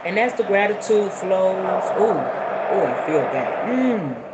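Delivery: slow, calm meditation guide; guided meditation. Acted. Guiding viewers into a calm sitting posture. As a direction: settle the listeners into their seats.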